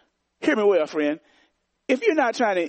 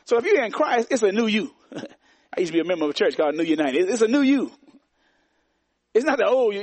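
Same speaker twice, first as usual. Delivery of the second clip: higher, insistent preacher's call; preaching; 4 semitones higher than usual. Acted. Pastor telling believers it's a new you, insistent. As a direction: press the point on the congregation.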